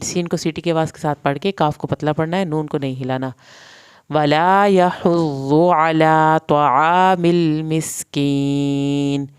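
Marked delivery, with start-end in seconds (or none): 4.1-9.2 slow, louder recited word; stretched tajweed recitation; Quran recitation. Shown as a demonstration. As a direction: chant it out slowly with a full heavy ṭā. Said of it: "سین کی زبر کو لمبا نہیں کرنا".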